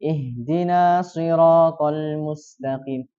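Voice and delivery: Ihdina siratal mustaqim with a single s and no shadda, very slow; slow recitation that drops the tasydid; missing doubled s. Shown as a demonstration. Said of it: "menghilangkan tasydid di huruf shad".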